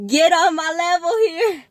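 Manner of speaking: high, loud playful holler; over-the-top high shout; hyped-up mock yell. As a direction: holler it like a karaoke show-off. Acting out blasting karaoke at maximum.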